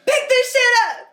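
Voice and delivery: high, loud mock yelling; mock drunk yell. Drunk client woken by money rain, high mock yelling. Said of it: "And he was like".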